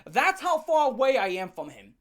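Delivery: normal talking voice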